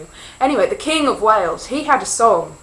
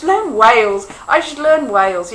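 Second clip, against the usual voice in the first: playful exclamation; 3 semitones higher, 8 dB louder than usual. Shown as a demonstration. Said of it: "the whole dolphin thing's getting to me".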